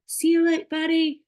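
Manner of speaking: high, loud, cheery goodbye; a sing-song farewell to the kid; breezy brush-off. Pretend. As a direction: toss it off brightly as you leave. Walking away mid-conflict.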